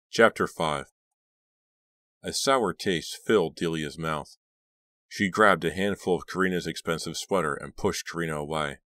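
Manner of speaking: usual speaking voice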